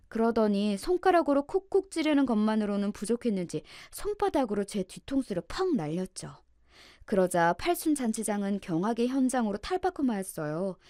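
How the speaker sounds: ordinary delivery, nothing marked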